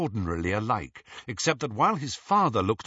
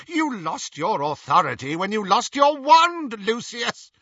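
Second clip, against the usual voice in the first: high, loud scornful retort; sneering; 12 semitones higher, 6 dB louder than usual. A narrator's reading. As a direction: throw it back with contempt. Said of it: "she sneered".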